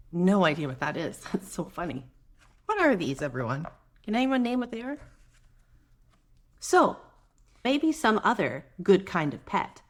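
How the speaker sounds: ordinary delivery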